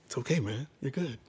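calm him down quietly; hushed brotherly reassurance; soft reassurance. Retold or imitated. Brother comforting him at altar.

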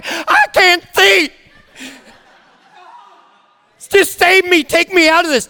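high, wailing plea for rescue; hammed-up childlike panic; mock crying. Believer begging the Heavenly Father like a child, wailing. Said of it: "we're crying and weeping".

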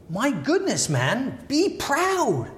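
high, rising pep-talk cry; urging exclamation. Retold or imitated. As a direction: shout encouragement to a beaten team. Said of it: "shaking them and say".

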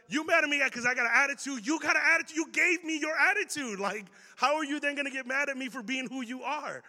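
high, loud, indignant back-talk; frustrated teen. Retold or imitated. Kid talking back to mad mom and dad, frustrated protest. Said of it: "How many of you get frustrated".

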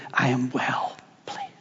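whispered softly and low; tender whisper. Acted. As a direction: breathe it out gently as a loving father. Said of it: "What a tender moment".

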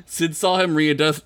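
ordinary delivery

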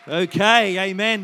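loud, excited okay over the crowd. Real, not acted. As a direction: ride the applause. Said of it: "cheering with crowd".

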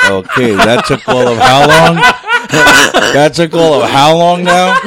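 loud, laughing through the words. Real, not acted. Host timing how fast the show sank, laughing. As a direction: ask it through big laughter.

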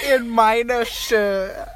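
high, slow echo in mock German; exaggerated German imitation; mimicked German accent. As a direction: parrot the German phrase, stretched out. Mimics her German, mockingly.

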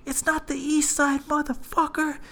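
high, drawn-out mock scream; imitated scream. Bystander screams out at the fight, high mock yell. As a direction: holler it slowly in a high strained pitch. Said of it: "Mexican dude screams out".

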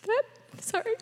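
real choked-up, high voice; tearful. Real, not acted. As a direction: break off and apologize through tears. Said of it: "go the whole morning without crying".

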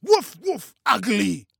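high, very loud triumphant-dog-chasing yell; loud barked taunt. A narrator's reading.

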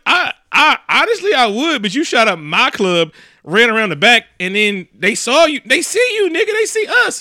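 high, incredulous mock shouting; exasperated outburst. Rick scolding the man who shot up his club, exasperated high yelling. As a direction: build to a squealed comic protest.

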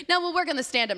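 high, brisk mock brush-off; imitating the producer shutting her down; dismissive producer. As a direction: wave her off like a know-it-all.

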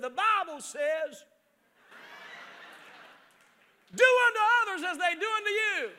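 put-on high, loud self-righteous child quoting scripture; the boy proudly justifying himself; cheeky kid. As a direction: son defending himself to dad with a verse. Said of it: "He said".

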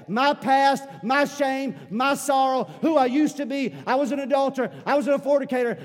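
loud, high-pitched revival sermon; full-force preaching. Acted. Preacher proclaiming all things new to the church, full-force preaching. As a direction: belt it out to the congregation, voice held high and driving.

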